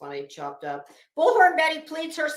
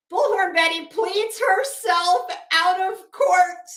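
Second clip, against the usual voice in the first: loud, slow, higher headline proclamation; triumphant announcement; 7 semitones higher, 9 dB louder, slower than usual. Acted.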